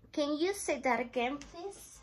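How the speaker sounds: ordinary talk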